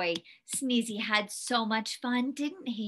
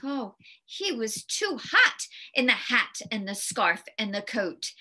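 plain reading voice

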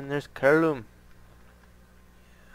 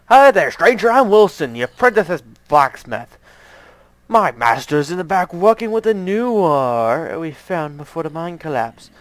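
much higher, louder hearty yell over the ringing; blacksmith Wilson; 4 semitones higher, 11 dB louder than usual. Acted. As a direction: call out over the anvil noise to the stranger. Reads the blacksmith Wilson's greeting.

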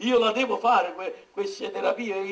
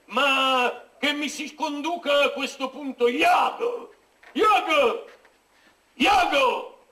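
pompous call; 4 semitones higher, slower than usual. Acted. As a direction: call Iago like a tragic hero. Othello calls Iago in pompous tone. (richiamo teatrale e altisonante)

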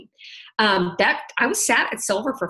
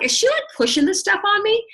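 incredulous; 13 semitones higher than usual. Retold or imitated. Mock suspicious self quote.

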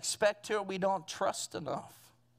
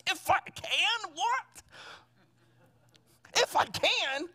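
incredulous Jesus; 7 semitones higher, slower than usual. Retold or imitated. Jesus echoing the father's words in disbelief. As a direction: pitch leaps up in disbelief.

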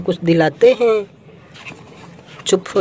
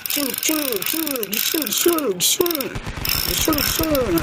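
high, soft chum chum shots like a toy gun; toy gun noises; 7 semitones higher, 7 dB quieter, slower than usual. Pretend. Chasing toy snakes away with a gun, soft high shooting noises.

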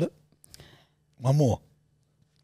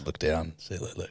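muttered; 8 semitones lower, 12 dB quieter than usual. Real, not acted.

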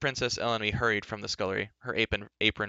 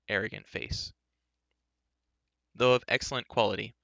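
ordinary delivery

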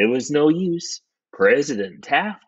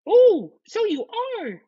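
piping wife taking it in; wife's realisation; slower than usual. Acted. Wife sees Taft is stuck.

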